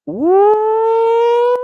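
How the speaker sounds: high, loud joyful whoop; excited whoop. Real, not acted. Host whooping loudly at a viewer's comment. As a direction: let out a big happy woo.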